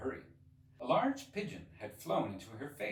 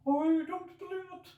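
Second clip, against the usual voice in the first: lamenting pigeon; 8 dB louder than usual. Acted. Pigeon ignoring Alice, fretful and aggrieved. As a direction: complain on and on like a fussy bird.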